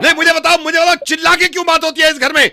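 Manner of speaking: very loud, high furious rant; venting husband shout. Pretend. Man venting at stranger standing in for wife, shouting. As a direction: scold at the top of your voice. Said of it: "wife की तरह सुन लो".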